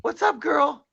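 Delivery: very high, loud pet talk. Real, not acted. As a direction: coo at the dog in a squeaky voice. Baby talk to dog.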